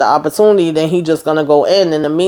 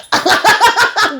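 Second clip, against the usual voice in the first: high laugh. Real, not acted. Speaker cracks up at scene.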